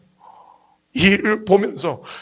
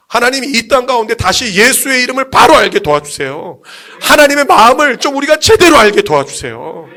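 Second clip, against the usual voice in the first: raised, fervent pleading from the pulpit; impassioned prayer; 4 dB louder than usual. Retold or imitated. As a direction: cry out to God with rising force. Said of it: "하나님 앞에 막 기도하게 되는".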